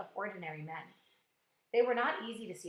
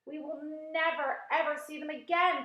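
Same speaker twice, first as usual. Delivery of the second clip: crying out; 6 semitones higher, 4 dB louder than usual. A narrator's reading. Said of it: "cried the ladybug".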